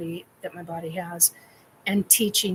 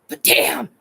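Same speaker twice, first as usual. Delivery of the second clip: louder, higher exclamation; exasperated outburst; 5 semitones higher, 8 dB louder than usual. Retold or imitated. Client protests to her trainer, exasperated outburst. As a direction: punch it out in mock exasperation.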